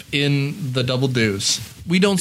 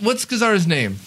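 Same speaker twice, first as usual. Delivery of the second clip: ordinary talk, nothing marked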